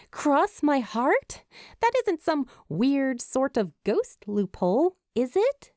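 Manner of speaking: suspicious, questioning; skeptical. A narrator's reading. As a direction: tease him with disbelief. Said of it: "she asks through a skeptic stare".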